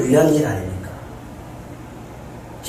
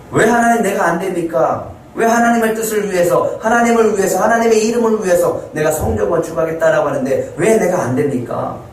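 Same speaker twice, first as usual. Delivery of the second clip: higher, pressing complaint; indignant protest; 6 semitones higher than usual. Pretend. Imagined believer complaining to God, indignant higher protest. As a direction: demand an answer from God. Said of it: "라고 할 수 있는데".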